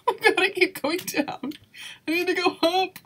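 rising panic at failing; exasperated. Real, not acted. Keeps falling down in game.

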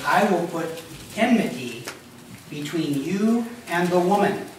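slow, measured reading of the verse; scripture reading. Acted. Preacher reads God's words to the serpent, slow and solemn. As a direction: read each phrase with weight.

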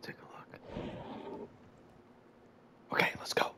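hushed unvoiced whisper. Real, not acted. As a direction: whisper so it won't hear. Sneaking past the monster.

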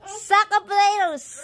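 loud high playful call upward; shouting up to balcony. Real, not acted. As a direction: call up to him teasingly.

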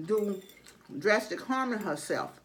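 chewing while talking; eating between words; mouth full of food. Real, not acted. Seen 5: Fork goes to mouth at 2.2; chewing with full cheeks follows.

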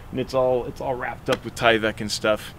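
ordinary talk, nothing marked

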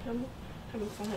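quiet, trembling after crying; speaking while still shaking; shaky voice. Real, not acted. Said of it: "trying to stop shaking".

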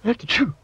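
big high-pitched sneeze; loud sneeze. Shown as a demonstration. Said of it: "a noise that sounds like this".

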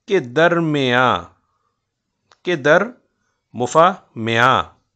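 "के दरमियाँ" drawn out slowly beat by beat; scanning syllables. Shown as a demonstration. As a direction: pace "के दर मुफा मियाँ" to the meter. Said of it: "देखिए बड़ी ये हमने फिर गिरा दी". ("के दरमियाँ" को वज़न पर धीरे-धीरे बोलना)

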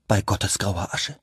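understated grave oath; bitterly serious. A narrator's reading. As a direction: swear it under the breath, in earnest. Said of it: "stieß Sim in bitterem Ernst hervor". (in bitterem Ernst)

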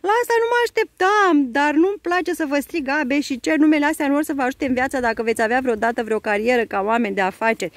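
flustered commander. A narrator's reading. The commander frets to the twins about their names. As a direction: fret over the silly names.

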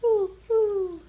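high, loud owl hooting. A narrator's reading. Owl calling in a bedtime story, high loud hoot. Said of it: "hooted Owl".

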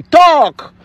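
a sharp, loud "Talk." pitched high; firm command. Retold or imitated. Mother urging her silent son, loud and firm. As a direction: order the son to speak up. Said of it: "It's even me that is tell my son".

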